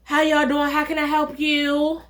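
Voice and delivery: high, put-on waiter voice; waiter's greeting. Waiter greeting the couple at the table, imitated in a high put-on voice. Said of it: "the waiter comes up like".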